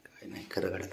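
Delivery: whispered under the breath; whispered aside. Real, not acted. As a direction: drop to a whisper, half to yourself. Muttered aside while the pet sulks.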